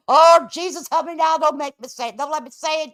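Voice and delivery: high plea, loud at first then easing off; mock pleading prayer. Host holding back a remark, mock-desperate prayer. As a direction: beg for restraint, hammed-up.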